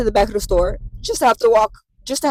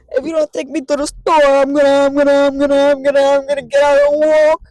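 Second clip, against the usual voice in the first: loud, higher stammering threat; whiny threat; 5 semitones higher, 5 dB louder than usual. Retold or imitated. Patient threatening the driver she'll walk, loud stammering whine. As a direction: sputter the threat to get out and walk.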